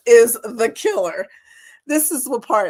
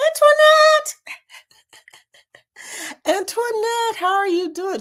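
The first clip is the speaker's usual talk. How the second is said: much higher, louder cheerful call; excited greeting; 12 semitones higher, 4 dB louder than usual. Real, not acted. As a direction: call out her name. Greeting a viewer.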